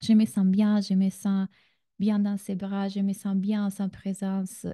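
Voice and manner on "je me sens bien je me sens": usual speaking voice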